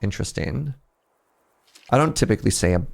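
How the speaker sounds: ordinary talk, nothing marked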